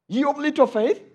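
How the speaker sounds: quizzical, rising "Ye of little faith?"; incredulous echo. Shown as a demonstration. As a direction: repeat the rebuke back in disbelief.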